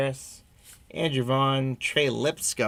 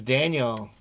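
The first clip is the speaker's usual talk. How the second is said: normal talking voice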